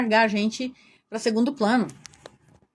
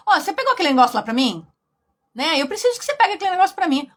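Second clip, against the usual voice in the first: fast, higher, louder ordering tone; suddenly brisk and demanding, no more suffering; bossy demand; 5 semitones higher, 4 dB louder, faster than usual. Retold or imitated. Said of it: "virou pra outra e falou assim". (mulher manipuladora dando ordem à acompanhante, rápida e mandona)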